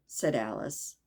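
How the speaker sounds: ordinary delivery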